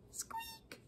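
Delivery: tiny, very high, soft squeak; mouse squeak. A narrator's reading. Little Mouse answering Duck, mouse squeak. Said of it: "said Mouse".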